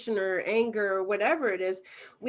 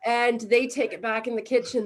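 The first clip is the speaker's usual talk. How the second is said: ordinary delivery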